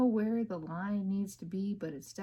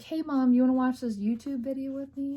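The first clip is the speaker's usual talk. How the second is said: put-on kid asking; 5 semitones higher, 5 dB louder than usual. Kid asks mom to watch.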